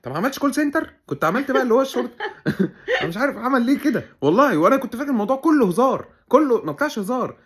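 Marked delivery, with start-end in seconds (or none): 2.2-3.0 a short high-pitched burst of laughter. Real, not acted. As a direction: crack up at your own joke. Laughing at the police joke. (ضحكة عالية قصيرة)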